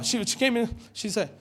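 ordinary talk, nothing marked

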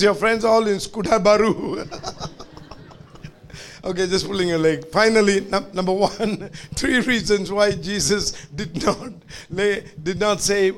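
ordinary talk

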